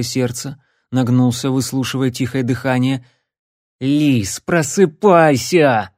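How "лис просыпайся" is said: slow, loud pleading shout; loud drawn-out call. Acted. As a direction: shout him awake. Shouting to wake him.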